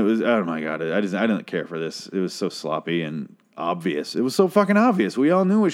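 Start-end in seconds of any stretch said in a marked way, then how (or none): 4.2-5.7 exasperated outburst. Real, not acted. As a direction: vent it like you can't believe it. Exasperated restatement.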